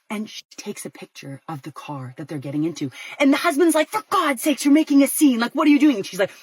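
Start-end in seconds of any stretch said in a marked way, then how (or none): 3.9-6.0 loud, pitched-up exasperated husband; exasperated scolding. Retold or imitated. Husband scolds wife for scene.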